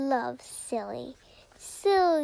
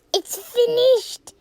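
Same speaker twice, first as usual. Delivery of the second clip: higher, louder and slow; excited announcement; 8 semitones higher, 8 dB louder, slower than usual. Real, not acted. Gamer announces to viewers the crafting is done, excited. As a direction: proclaim it triumphantly.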